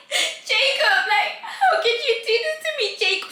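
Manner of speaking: high, whining mock sobs; imitated crying. Crying girl pleading with Jacob, mock tearful. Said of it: "She keeps crying".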